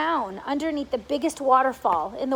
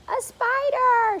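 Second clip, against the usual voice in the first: shrill, slow cry of alarm; cried out; 12 semitones higher, 5 dB louder, much slower than usual. A narrator's reading. Aunt spotting a spider on the ceiling, high shriek. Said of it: "she cried".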